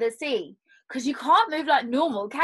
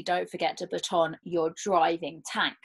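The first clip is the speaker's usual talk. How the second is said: ordinary delivery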